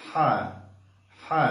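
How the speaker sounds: হা breathed from the throat, not Bengali হা; Arabic throat ha. Shown as a demonstration. Teacher showing the Arabic ha, not Bengali. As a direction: press lightly in the throat for হা. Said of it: "এইভাবে সুন্দরভাবে আদায় করতে হবে".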